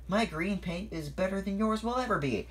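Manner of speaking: high, taunting call; Percy. A narrator's reading. Percy teases someone behind the stones, calling out. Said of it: "Percy called to someone".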